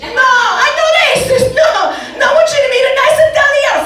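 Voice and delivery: put-on high, loud Italian-accented mother; mock Italian accent. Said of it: "stop with the accent".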